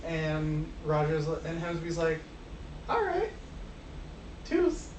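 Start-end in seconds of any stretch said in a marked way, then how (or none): 2.9-4.9 put-on high-pitched farewell, southern accent forgotten; high, light, airy sign-off; Hamsby. Hamsby seeing Bert off, high put-on voice. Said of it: "but in a southern accent that I forgot about".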